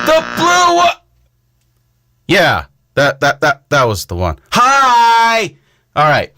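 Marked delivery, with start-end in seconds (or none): 0.1-1.0 high pushy direction; high urgent call. Real, not acted. Directing someone to the button.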